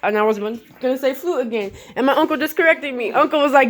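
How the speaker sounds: ordinary delivery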